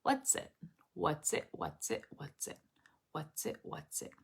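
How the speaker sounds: very soft, dropping almost to nothing; quiet self-practice loop; repetitive sound drill. Shown as a demonstration. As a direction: murmur it over and over to yourself. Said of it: "Then I would say".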